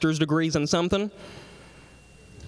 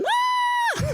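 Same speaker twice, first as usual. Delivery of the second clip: very high, held mock shriek; imitated scream. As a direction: scream comically high like someone in sudden pain. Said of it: "he started screaming".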